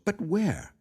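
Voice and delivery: pressing question. Acted. Miss Ivors pressing question.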